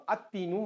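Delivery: put-on "التینو" said at-teenu with a doubled t; assimilated lam. Shown as a demonstration. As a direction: drop the l in "التینو", lean on the t. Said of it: "الف کو direct اس سے ملاتے ہیں". ("التینو" کو لام کے بغیر، مشدد ت سے)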